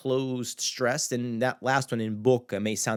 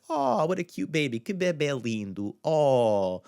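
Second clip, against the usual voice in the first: put-on cooing at a baby; 5 semitones higher than usual. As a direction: gush over a cute baby. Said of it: "like, you know, like".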